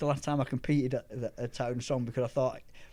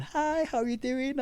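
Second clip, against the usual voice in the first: slow, very high-pitched imitation of girls; 14 semitones higher than usual. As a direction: mimic girls calling hello, drawn out. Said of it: "all the girls were going".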